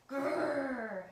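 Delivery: loud frustrated growl; angry growl. A narrator's reading. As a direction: growl in rage. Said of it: "cried Doctor Reaper".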